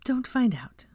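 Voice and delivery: whisper. A narrator's reading. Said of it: "whispered warning".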